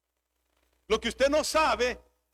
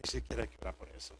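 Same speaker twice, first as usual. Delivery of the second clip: hushed fast whisper; quick breathy aside to the congregation; 12 semitones lower, 12 dB quieter, much faster than usual. Shown as a demonstration. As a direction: drop to a secret whisper. Preacher repeats line, then whispers it.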